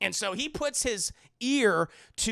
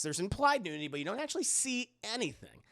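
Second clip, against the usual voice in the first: ordinary talk, nothing marked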